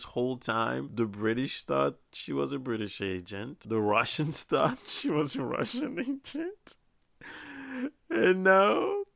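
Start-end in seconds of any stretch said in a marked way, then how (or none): 3.6-9.0 higher voice breaking into laughter; laughing. Real, not acted. Reviewer recapping who fooled whom, laughing. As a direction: talk through real amusement.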